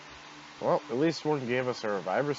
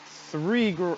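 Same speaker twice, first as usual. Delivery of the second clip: usual speaking voice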